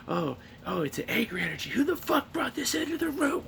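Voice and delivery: a guru lady working herself into outrage; angry spiritual lady. Retold or imitated. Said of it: "this lady starts being like".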